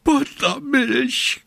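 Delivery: very high, drawn-out screech; screeching raven. A narrator's reading. Raven to little witch after botched spell, shrill screech. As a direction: screech the word slowly like an outraged bird. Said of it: "kreischte Abraxas".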